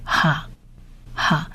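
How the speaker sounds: "哈" as a short, throaty kha, softly; Hebrew syllable model. Shown as a demonstration. Teacher shows how the last letter is read, syllable model. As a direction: say "哈" as an isolated kha. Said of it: "这个字念哈".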